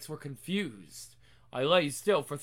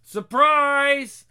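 loud gleeful surprise; spring the joke loudly; excited shout; 7 semitones higher, 10 dB louder than usual. Acted. Revealing the prank.

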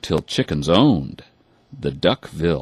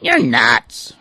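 high, louder sassy duckling voice; cheeky duckling; 7 semitones higher, 6 dB louder than usual. A narrator's reading. Duckling talking back to his mother, high. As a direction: brush her off rudely. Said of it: "her son replied".

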